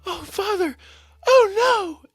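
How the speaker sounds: very high, loud hammed-up shriek; theatrical squealed distress; mock alarm. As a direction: wail a fake warning to father. Acting out faked alarm at car.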